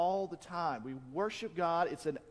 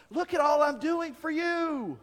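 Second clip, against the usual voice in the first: much higher and louder, a whiny look-at-me plea; mocking religious show-off; 10 semitones higher, 8 dB louder than usual. Preacher mocking religious people who crave credit, high and loud whine. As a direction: ham up a self-righteous showoff begging God for notice. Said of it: "And there's like".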